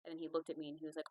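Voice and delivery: ordinary talk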